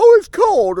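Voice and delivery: high, loud mocking whine of a complaining customer; whiny customer. Restaurant owner mocking complaining customers with a loud high whine. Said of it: "people come to my restaurant".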